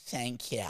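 "thank you" drawn soft and quiet; rich American thank you. Shown as a demonstration. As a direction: breathe "thank you" softly, posh American. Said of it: "they're like".

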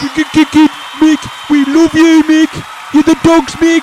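screaming fan. Pretend. Pretend fans screaming at star.

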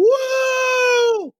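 high loud whoa shout; retold cheer. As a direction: yell whoa from far behind. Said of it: "he's in the back going".

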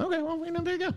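high squeaky cartoon mouse voice; piercing squeak like a cartoon mouse; Mickey Mouse voice. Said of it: "like a bad Mickey Mouse impression".

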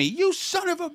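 high-pitched imitation of a mad cop; mock anger. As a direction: mimic the cop's angry outburst. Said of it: "he's also mad at me".

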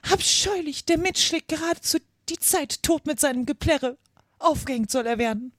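high-pitched indignant exclamation; Queen's outcry. A narrator's reading. Queen denouncing the Hatter's singing, indignant high outcry. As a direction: cry it out as an outraged queen. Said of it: "als die Königin ausrief".

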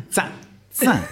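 tsa as a sharp separate syllable; isolated ts sound. Shown as a demonstration. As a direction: demonstrate tsa, t and s run together. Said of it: "You go tsatsiki".

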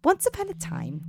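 high lilting fairy tale start; storybook opening. Shown as a demonstration. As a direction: open like a bedtime story. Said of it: "you ready for a little fairy tale?".

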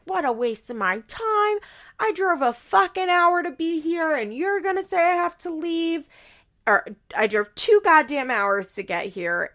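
high, huffy ranting mother; mock indignant. Grandmother complains about the long drive, huffy rant. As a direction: vent like an offended grandmother. Barbara's angry retort, retold.

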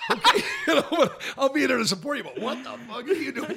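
high, laughing disbelief; incredulous laughing. Retold or imitated. Reacting to a friend's jumpsuit impressions, laughing disbelief. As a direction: humour him while laughing at him. Friend's odd [name]-Columbo act.

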